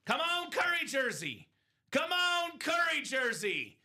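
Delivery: high, eager cheering for luck; root hard for the prize; excited cheering. Real, not acted. Cheering the wheel spin.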